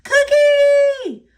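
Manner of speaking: loud, very high shout of cookie; children shouting. A narrator's reading. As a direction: kids yelling across the playground. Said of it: "Shouts of".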